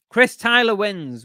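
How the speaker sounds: drawn-out announcer call for the winner; winner announcement. Real, not acted. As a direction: call out the winner's name with a flourish. Announcing the duck race winner.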